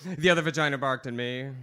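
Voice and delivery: ordinary talk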